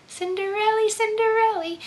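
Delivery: high dreamy Cinderella; lilting fairy tale voice. Shown as a demonstration. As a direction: float the name like a storybook princess. Repeating the fairy tale name.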